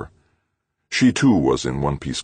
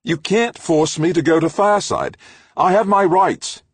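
much higher and louder, indignant, the last words slowed; angry protest; 7 dB louder than usual. Acted. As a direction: fling it back at him in outrage. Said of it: "Anger flared".

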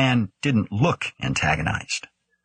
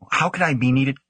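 higher-pitched objecting question; incredulous protest; 6 semitones higher than usual. A narrator's reading. Young scientist questioning the director's decision, incredulous. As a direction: object in disbelief, pitch lifted. Grant protests being sent away.

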